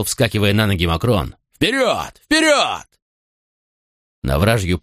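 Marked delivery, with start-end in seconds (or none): 1.5-2.2 high urging cry; roared command. Acted. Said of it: "взревел". 2.2-2.9 full shout. Acted. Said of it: "взревел".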